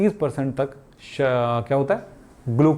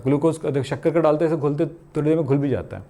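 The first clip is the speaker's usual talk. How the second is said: usual speaking voice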